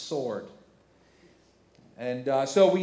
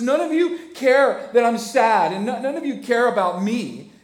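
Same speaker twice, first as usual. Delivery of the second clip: petulant king; 7 semitones higher, 7 dB louder than usual. Acted. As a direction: complain like a sulky king. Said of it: "he says".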